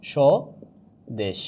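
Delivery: স্বদেশ very slowly as "sho... desh", silent b; pronunciation demo. Shown as a demonstration. Teacher showing students how the word is pronounced, slow syllable demo. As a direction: draw out স্বদেশ in two parts, "sho-desh". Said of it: "তাহলে উচ্চারণটা হয়ে যাচ্ছে".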